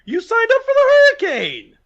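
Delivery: mock shout. Real, not acted. Mock shouting match about hurricane.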